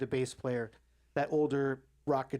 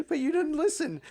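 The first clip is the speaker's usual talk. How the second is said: high, louder squeaky mock whine; boyfriend's retort; 12 semitones higher, 4 dB louder than usual. The boyfriend tells his girlfriend she never listened, high mock whine. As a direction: whine it back in a tiny high voice. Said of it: "And he goes".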